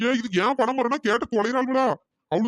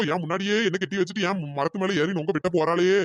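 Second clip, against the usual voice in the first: ordinary talk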